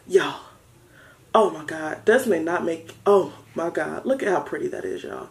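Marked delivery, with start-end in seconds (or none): none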